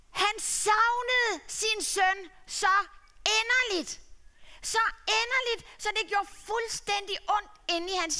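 tender storyteller, drawn out; heartfelt story narration. A narrator's reading. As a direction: read it as if your heart aches. Narrator's emotive telling.

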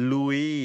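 Louis with no final s, "LOO-ee"; Louis ending on ee; British pronunciation of Louis. Shown as a demonstration. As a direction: say Louis the French way, silent S. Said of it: "คนอังกฤษเนี่ยเขาจะ pronounce".

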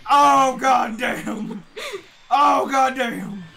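drawn-out shout. Real, not acted. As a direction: drag the shout out in shock. Big hit in game.

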